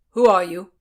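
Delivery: loud, sharp demand; shouted demand for a name. Acted. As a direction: demand it at full volume. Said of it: "Blackjack demanded".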